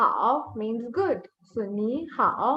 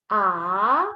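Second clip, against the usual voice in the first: long rising AH; second tone; 5 semitones lower than usual. Shown as a demonstration. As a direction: start AH low and glide it up. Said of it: "Your pitch goes up".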